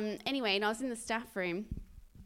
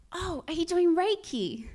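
surprised question; 7 semitones higher, 5 dB louder than usual. Retold or imitated. Colleague's puzzled question, acted for laughs.

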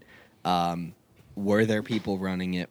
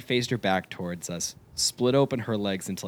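ordinary delivery

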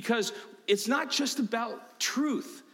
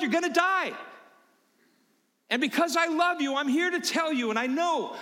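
much higher, louder urgent warning; urgent loving plea; 10 semitones higher, 3 dB louder than usual. Retold or imitated. Pastor pleading with someone heading off the cliff, urgent and loud. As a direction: plead with them, pressing hard. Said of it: "I'm over there in front of 'em saying".

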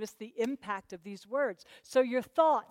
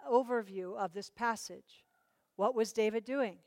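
ordinary talk

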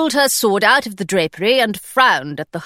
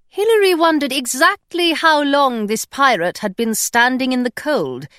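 ordinary delivery

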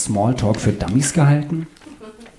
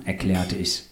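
ordinary delivery, nothing marked

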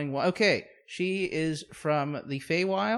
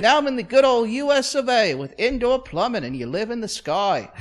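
higher, louder comic British voice; English immigrant storyteller; 7 semitones higher, 6 dB louder, faster than usual. Acted. English newcomer marvelling at America, excited mock British accent. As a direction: gush in a put-on English accent. Acts English character's arrival.

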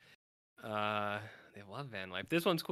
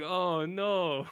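mock dismay; 8 semitones higher, 8 dB louder than usual. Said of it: "And then it's like".

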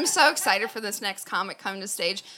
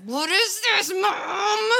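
high, louder shouted question; shouting at mom; 11 semitones higher, 4 dB louder, much slower than usual. Retold or imitated. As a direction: yell it at your mom in disbelief. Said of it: "I was like".

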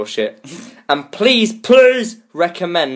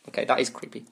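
ordinary delivery

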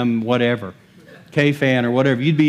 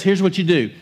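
ordinary talk

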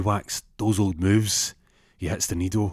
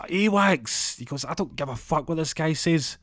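defiant Awax; 9 semitones higher than usual. Retold or imitated. Donald declares new identity.

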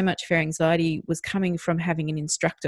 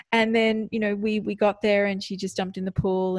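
ordinary talk, nothing marked

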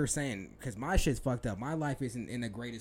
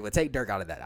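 louder, worked-up outburst; real excitement spilling over; a little excited; 6 semitones lower, 7 dB louder, faster than usual. Real, not acted. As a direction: blurt it out, carried away by the point. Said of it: "I got a little excited".

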